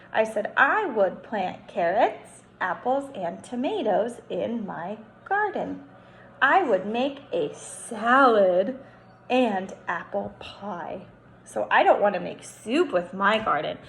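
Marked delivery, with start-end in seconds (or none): 0.6-11.0 reading own sentence aloud. Shown as a demonstration. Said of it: "So let's read what I wrote".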